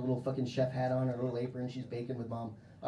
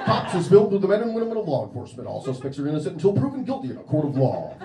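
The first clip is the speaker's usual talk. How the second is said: announcer read; 4 semitones higher, 9 dB louder, faster than usual. Pretend. Parodies Cops intro announcer.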